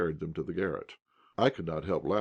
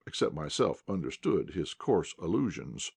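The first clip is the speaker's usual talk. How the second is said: ordinary delivery, nothing marked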